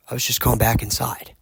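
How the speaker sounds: ordinary talk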